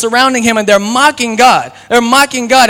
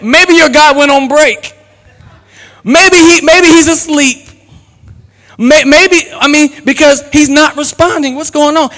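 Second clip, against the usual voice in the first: higher-pitched, drawn-out taunt; retold jeer, pitched up; mocking Elijah; 5 semitones higher than usual. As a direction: needle them with mock-innocent suggestions. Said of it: "he was laughing at them".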